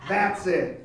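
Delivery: normal talking voice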